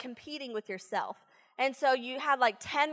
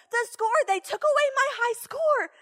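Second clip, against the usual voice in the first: put-on a lightly higher whiny kid voice; upset child; 11 semitones higher, 4 dB louder than usual. Boy protesting his high score was taken, upset. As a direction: complain like a distressed boy.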